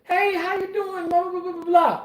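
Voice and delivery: high-pitched sing-out hello, then rattled blahs; fake-friendly greeting. Mimicking a two-faced coworker greeting someone, fake-friendly. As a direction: gush a high, phony hello.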